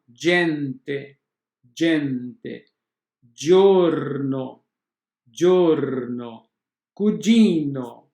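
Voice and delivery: gente, giorno and cugino with a "dj" sound. Shown as a demonstration. Teacher to students showing g pronounced dj. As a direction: model each g word with a clear "dj".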